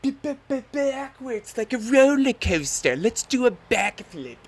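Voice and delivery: high, loud stammering thrill; imitating the instructor. Instructor announcing the backflip, high and loud. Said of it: "he's like".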